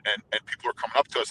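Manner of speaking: normal talking voice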